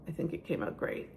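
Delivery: hushed admiring whisper. Real, not acted. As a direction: breathe it out in awe. Admiring the zucchini bread.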